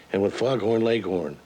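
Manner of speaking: ordinary talk, nothing marked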